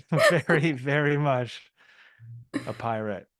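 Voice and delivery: laughing, loud and high; amused laughing. Real, not acted. Storyteller amused by the old pirate, laughing. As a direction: break into a laugh while stressing it.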